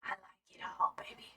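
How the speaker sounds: seductive whisper. Pretend. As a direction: breathe it out flirtily. Flirty joke about rainbow spectrum.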